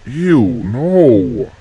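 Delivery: disgusted. Real, not acted. Disgusted, then stern.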